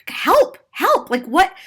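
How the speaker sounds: high-pitched, incredulous appeal; mock plea for help. Passenger silently appeals to gate agent, high mock plea. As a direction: squeal it lightly as a silent plea. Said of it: "I was like".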